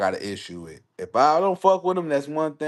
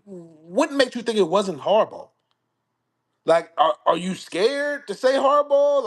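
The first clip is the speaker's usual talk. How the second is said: pointed challenge; 7 semitones higher, 5 dB louder than usual. Real, not acted. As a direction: lean on it like a dare. Challenging D-Hop on air.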